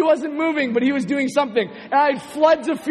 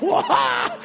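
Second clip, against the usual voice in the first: laughing mock yelp; 4 semitones higher than usual. Startled by glowing eyes, laughing.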